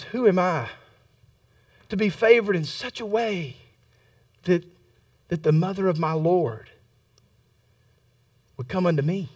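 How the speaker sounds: very soft, low, halting wonder; humbled, pausing between hushed phrases; overcome with awe. Acted. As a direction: murmur it as if overwhelmed by the honour. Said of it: "she's overcome by the, by the moment".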